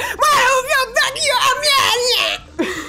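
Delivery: high, garbled ranting yell; hammed-up shrieky tirade; mock yelling. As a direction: imitate an enraged talk-show guest screaming. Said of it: "so her yelling was kinda pointless".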